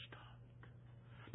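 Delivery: whispered, the word almost gone; dramatic whisper. Acted. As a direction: let it die out in a whisper. Alarm clock ran down.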